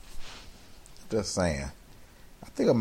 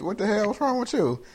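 coworkers baffled; 11 semitones higher than usual. Retold or imitated. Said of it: "everybody gonna be like".

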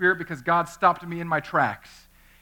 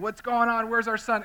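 mock hysterical yell; 8 semitones higher, 4 dB louder than usual. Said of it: "She's hysterically yelling, crying".

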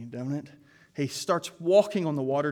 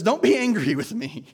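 anxious plea; 5 semitones higher, 6 dB louder than usual. Retold or imitated. Gideon pleading.

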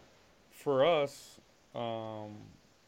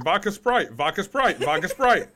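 louder, insistent retold order; exasperated repeating; 4 semitones higher, 6 dB louder than usual. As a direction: keep hammering the order at a baffled bartender. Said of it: "they have no idea what I'm saying".

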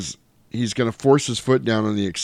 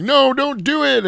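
high pleading warning; 4 dB louder than usual. Retold or imitated. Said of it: "Michelle Rodriguez was like".

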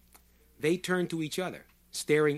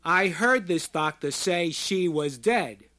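loud, raised and deliberate; slow, emphatic shout; 4 semitones higher, 7 dB louder, slower than usual. A narrator's reading. Short man shouting into sudden silence, slow and emphatic. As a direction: shout it slowly, weighting each word. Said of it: "This he shouted slowly and emphatically".

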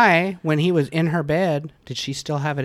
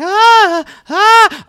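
high, loud imitated gasps; two sharp, high "ah" cries; hammed-up caught-in-the-act gasping; mock startled gasps; 14 dB louder than usual. Mimics mother interrupted in bed.

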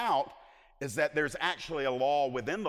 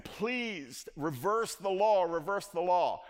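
slow, drawn-out plea, lightly acted; retold begging without real tears; Esther pleading; 5 semitones higher, slower than usual. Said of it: "as Esther is pleading, crying, weeping".

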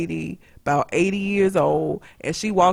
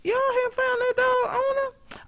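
high surprised question; 3 dB quieter than usual. Retold or imitated. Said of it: "I was like".

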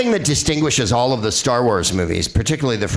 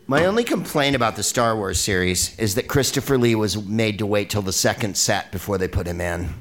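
usual speaking voice

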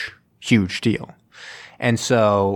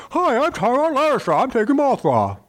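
much higher, hammed-up put-on voice; Tywin Lannister impression. Podcaster redoing Tywin after being teased, hammed-up high voice. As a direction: redo the villain in a silly high voice. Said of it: "That was a terrible Tywin Lancaster impression".

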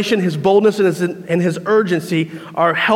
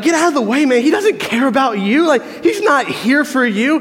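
higher, scoffing crowd telling a fan off; annoyed crowd voice; 6 semitones higher than usual. Retold or imitated. Preacher voicing the crowd shooing off a fan, higher and dismissive. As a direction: brush the pushy fan off from above.

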